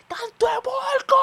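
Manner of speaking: very high, drawn-out full-force shout; very hard yell. Retold or imitated. Young man yells at the littering woman, very hard drawn-out shout. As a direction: imitate a bystander bellowing an insult. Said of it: "viene y grita".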